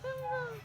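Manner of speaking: playful high vroom vroom; mock engine noise. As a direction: make car sounds for the baby. Mother mimics car sound for baby. (gayang tunog ng sasakyan)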